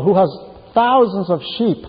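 ordinary talk

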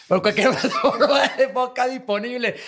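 laughing, running out of air; out of breath. Real, not acted. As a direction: squeeze the last words out laughing.

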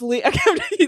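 usual speaking voice

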